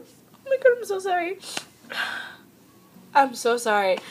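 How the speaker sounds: choking up, apologizing through tears; real crying. Real, not acted. Thanking people who helped her, crying and apologizing. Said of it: "I promise I'm just crying".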